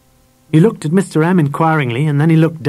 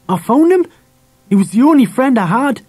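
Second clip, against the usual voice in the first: put-on high, loud boyish protest; pleading boy; 11 semitones higher, 4 dB louder than usual. A narrator's reading. Harry defends his only friend to Dad, pleading. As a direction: plead for the dog, pitched right up.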